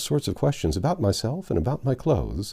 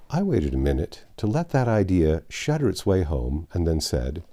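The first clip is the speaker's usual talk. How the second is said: plain reading voice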